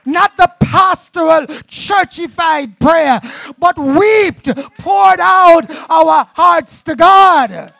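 shouted, high-pitched sermon appeal; impassioned preacher at full cry; preaching climax. Acted. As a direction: build to a full shout, hammering each phrase.

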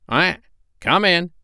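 high quack, then a drawn-out invitation; calling duck. A narrator's reading. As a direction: call out slowly as a duck. Duck calls out at a knock.

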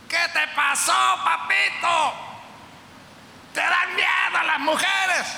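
voz burlona de vago de esquina, más aguda y lenta; burla de los vagos. Retold or imitated. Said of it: "qué les hubieran dicho los vagos de la esquina". (imitando con sorna a los vagos de la esquina)

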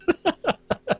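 short high-pitched giggle. Real, not acted. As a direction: giggle at your own joke. Laughs after goddamn mind remark.

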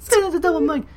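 high-pitched mocking imitation; playful, squeezed-up mimicry of a fighter; McGregor. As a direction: echo the catchphrase way up high.

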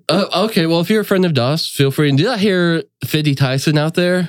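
an apologetic, unsure hostess; polite confused sister. Acted.

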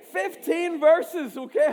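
drawn out with a laugh; emphatic call-out. Shown as a demonstration. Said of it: "Say fifteen".

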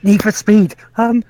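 awkward streamer. Retold or imitated. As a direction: stumble through it like a nervous streamer. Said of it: "this falls into the awkward streamer problem".

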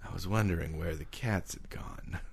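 low, hushed detective voice-over; noir narration. Pretend. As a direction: brood like a hard-boiled detective.

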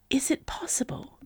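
high, airy whisper; hushed, whispered question. A narrator's reading. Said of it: "in a whisper".

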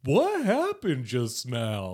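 slow, dopey drawn-out question; mock dumb-guy bewilderment; clueless Jethro. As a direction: ask it like a lost simpleton. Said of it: "Jethro is still".

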